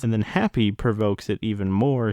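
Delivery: ordinary delivery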